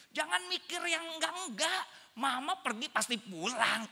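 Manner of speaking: high-pitched motherly scolding; exasperated mother. Retold or imitated. Mother setting her worrying son straight, exasperated. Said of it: "langsung aja tuh ngomong spontan".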